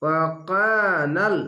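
ওয়াকানাল slow, with the কা stretched one alif; Quranic recitation demo. Shown as a demonstration. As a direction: draw out the কা in ওয়াকানাল. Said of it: "এক আলিফ টেনে পড়বেন". (কা এক আলিফ টেনে পড়া)

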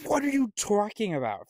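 high-pitched incredulous outburst, falling at the end; indignant disbelief. Acted. Arguer negating whatever the opponent says, indignant disbelief. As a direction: protest as if wrongly accused. Acted example of a contrary arguer.

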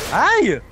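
sharp yelp of pain. Real, not acted. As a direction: yell out as if hit. Hit in game, yelps.

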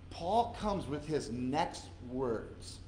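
ordinary talk

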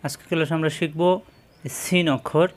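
usual speaking voice

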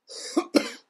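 harsh cough from pepper heat; coughing, throat on fire. Real, not acted. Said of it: "It's hard to breathe".

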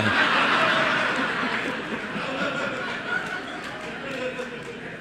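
long breathy laugh, high and airy; hearty laugh at the punchline; laughter. Real, not acted. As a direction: let the laugh roll out. Audience laughs at his joke.

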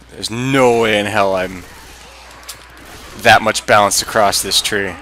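ordinary talk

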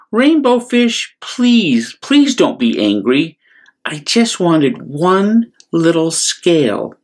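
high, slow gentle plea; pleading little fish. Acted. Little blue fish pleading with Rainbow Fish, high and gentle. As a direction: gently beg him not to be angry.

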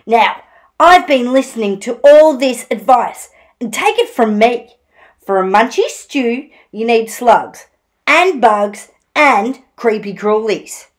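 loud, emphatic advice; prickly story-time echidna; know-it-all Echidna. Acted. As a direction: list each ingredient with authority. Voices Echidna giving advice.

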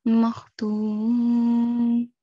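makhtum with a long, level "tuu" closing on "m"; pausal sukoon form. Shown as a demonstration. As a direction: drop the "-in" and hold "tuum". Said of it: "repeat after me".

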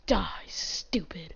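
slow menacing whisper. Acted. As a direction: hiss it slowly under your breath. Whispered threat to a mob.

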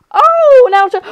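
high, loud and fast gush; excited. Real, not acted. Streamer spotting the husband and wife, excitedly fast. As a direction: gasp and rush with excitement.

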